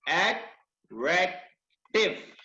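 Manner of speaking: "Attractive" very slow, in three separated syllables. Shown as a demonstration. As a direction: split "attractive" into long, detached beats. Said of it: "इसी तरह".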